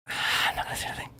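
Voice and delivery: quick, breathy whisper with a sigh first; sigh, then whisper it fast as a secret. Shown as a demonstration. Repeats the line in a whisper.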